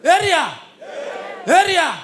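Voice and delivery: loud call to the crowd; shouting Area. Acted.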